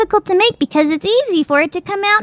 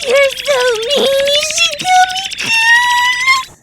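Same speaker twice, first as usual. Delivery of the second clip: whiny wail; 11 semitones higher than usual. Acted. Judge wailing at Ishigami for being mean, whiny. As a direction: wail his name.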